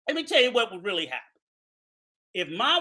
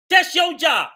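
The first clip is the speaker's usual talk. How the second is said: loud, higher angry shout; 5 semitones higher, 5 dB louder than usual. Real, not acted. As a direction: shout him down. Angry rant.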